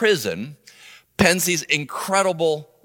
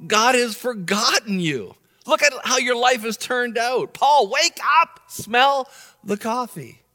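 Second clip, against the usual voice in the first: incredulous pushback; 7 semitones higher than usual. Pretend. Preacher talking back to Paul, mocking disbelief. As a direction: shake Paul awake with disbelief. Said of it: "interrupt Paul and say".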